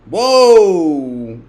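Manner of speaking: loud, high exclamation of shock; startled loud whoa; surprised whoa. Real, not acted. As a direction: react to a huge price jump. Sees chart jump.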